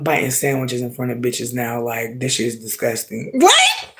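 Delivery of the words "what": a sudden outraged shriek of a question; shocked squeal. Real, not acted. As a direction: squeal it very high and loud in disbelief. Outraged at sandwich price.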